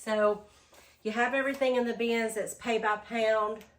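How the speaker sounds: ordinary talk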